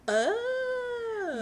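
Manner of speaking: long, high drawn-out "oh"; acted-out moment of getting it; dawning realization. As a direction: let it click on a high, held note. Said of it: "kind of see that and go like".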